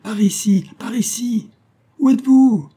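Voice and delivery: high, urgent hail across distance; searching cry, ending very loud and high; calling out. A narrator's reading. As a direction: shout directions to someone out of sight. Rescuers and lost men calling.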